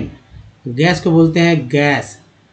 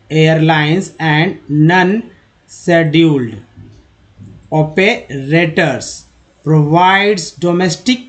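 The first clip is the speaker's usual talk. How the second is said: slow reading aloud; much slower than usual. Shown as a demonstration. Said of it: "teacher reads sentence slowly".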